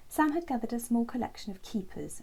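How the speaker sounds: usual speaking voice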